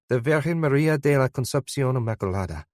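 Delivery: put-on quick, fluent Spanish accent; convincing Spanish pronunciation, said quickly. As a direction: rattle it off like a native Spanish speaker. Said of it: "in a convincing Spanish accent".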